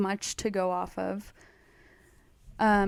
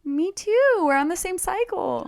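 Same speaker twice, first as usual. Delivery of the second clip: playful, much higher squeaky voice; high mock voice; 10 semitones higher, 5 dB louder than usual. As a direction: joke in a high, girly voice. Joking about men's cycle.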